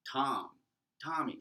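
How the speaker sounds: "Tommy" called out loud and drawn out, pitch rising; calling out a name. Shown as a demonstration. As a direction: call "Tom, Tommy" as if across the room. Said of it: "like you're calling somebody".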